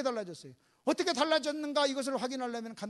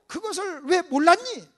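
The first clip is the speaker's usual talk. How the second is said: loud, raised-pitch reproachful questions; staged scolding; acted rebuke; 8 semitones higher, 9 dB louder than usual. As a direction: press each question hard. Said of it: "하고 지금 책망하는 거예요".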